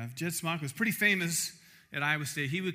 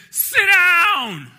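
very loud, high scream; a piercing shouted order to silence the room; screamed command; 19 dB louder than usual. Retold or imitated. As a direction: scream it at the top of your lungs. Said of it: "I screamed at the top of my voice".

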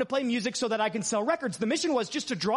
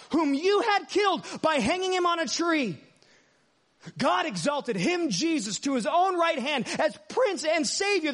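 preaching scripture; 4 semitones higher than usual. Acted. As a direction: proclaim the verses with fire.

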